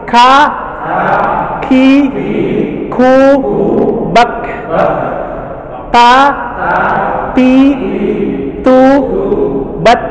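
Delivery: Ka, ki, ku, bak, Ta, ti, tu, bat with air after each; breathy drill for repeating. Shown as a demonstration. Teacher leading students to repeat aspirated syllables. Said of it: "ikuti, Pak".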